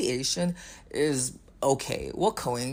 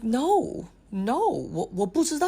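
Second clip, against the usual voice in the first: flustered denial; 8 semitones higher than usual. Retold or imitated. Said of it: "And I'm like".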